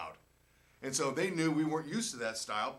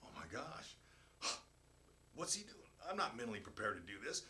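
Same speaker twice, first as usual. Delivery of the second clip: much softer nervous thought; inner worry; 4 semitones lower, 10 dB quieter than usual. Retold or imitated. Player dreading the worm dare, hushed inner voice. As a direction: say it under your breath, dreading it. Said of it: "I'm telling myself".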